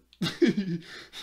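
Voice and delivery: loud, high real laugh; short high cackle. Real, not acted. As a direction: burst into giggles. Cracks up while cutting.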